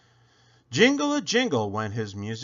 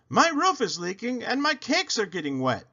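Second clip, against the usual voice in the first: moaned; 10 semitones higher than usual. A narrator's reading. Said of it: "he moaned".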